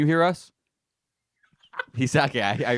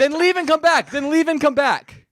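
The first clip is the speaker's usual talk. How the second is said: high, loud, insistent shout; calling out; 12 semitones higher, 6 dB louder than usual. Real, not acted. Host instructing muted co-host to rejoin, calling out. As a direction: call it out firmly to someone struggling to hear.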